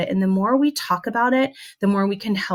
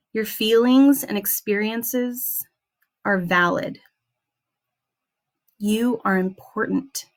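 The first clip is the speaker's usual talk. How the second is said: guided affirmation. Acted. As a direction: guide the listeners through each affirmation, slowly.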